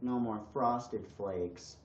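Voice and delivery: soft, very slow, crestfallen sigh of a line; hammed-up gloom, soft and dragged out; mock dejected. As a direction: play it as tragic over cereal. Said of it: "And then you're like".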